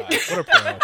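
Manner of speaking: "What a pro" squeaked out high on a breathless laugh. Real, not acted. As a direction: laugh the praise out. Laughing praise of Marcy.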